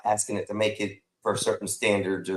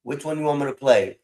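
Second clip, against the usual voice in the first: normal talking voice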